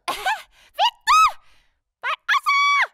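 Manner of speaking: high loud frustrated shriek. Real, not acted. Fails to laugh, shrieks.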